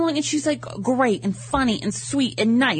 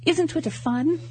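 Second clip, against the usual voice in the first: lightly voiced English star, pitched up; breezy, higher celebrity reply; 5 semitones higher than usual. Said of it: "She goes / Like that".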